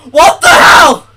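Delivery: real alarmed yell; shouted. Real, not acted. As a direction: yell it at the screen. Shock at glitching game.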